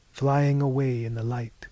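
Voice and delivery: plain reading voice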